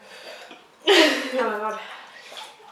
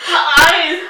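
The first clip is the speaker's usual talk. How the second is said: pained cry; 5 semitones higher, 9 dB louder than usual. Real, not acted. Eyes watering from spice.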